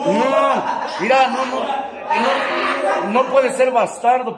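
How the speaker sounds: loud, laughing no, no, no; laughing protest. Real, not acted. Laughing off a listener's silly question, loud and amused. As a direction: burst out laughing, waving it off.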